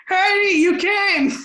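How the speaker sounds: high, drawn-out mock cry of relief; hammed-up greeting to a rescuer; elated Peter Parker. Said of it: "and Peter says".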